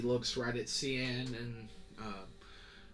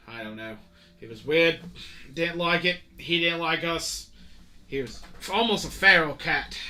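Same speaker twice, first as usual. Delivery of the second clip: higher, louder sailor voice rambling a report; chatty navy sailor; 6 semitones higher, 10 dB louder than usual. Acted. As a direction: report to the captain, puzzled and gossipy. Sailor reports search results.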